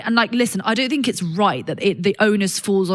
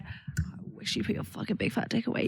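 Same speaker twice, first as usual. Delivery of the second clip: unvoiced sultry whisper; 14 dB quieter than usual. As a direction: breathe it seductively. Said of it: "Stand in the mirror".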